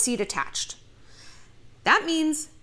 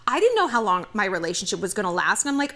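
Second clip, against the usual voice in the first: usual speaking voice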